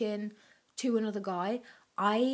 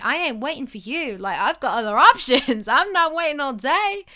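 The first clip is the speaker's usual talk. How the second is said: defiant; 4 semitones higher, 10 dB louder than usual. Retold or imitated. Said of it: "make him think".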